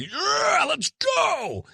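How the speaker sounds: high, far-off pumped-up cheer; hammed-up cheer; mock hype. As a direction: mimic a hyped "let's go" yell. Mock hyped-up energy.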